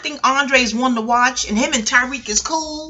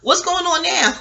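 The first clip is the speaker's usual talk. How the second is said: put-on confused old lady; 6 semitones higher than usual. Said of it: "be like".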